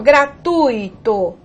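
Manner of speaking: "gratuito" said gra-TUI-to, U strong and I weak; stronger U. Shown as a demonstration. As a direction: lean on the U in "gratuito". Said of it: "pronúncia mais forte para o U". ("gratuito" com força no U)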